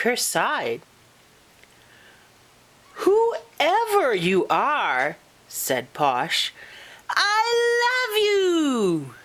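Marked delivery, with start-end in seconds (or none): 3.0-5.2 slow, high-pitched fairy voice; adoring fairy to an unseen friend; grateful little fairy. Acted. Posh grateful to unseen ally. 7.1-9.1 very high, drawn-out adoring squeal; hammed-up adoring fairy; gushing affection. Posh gushes to her new ally.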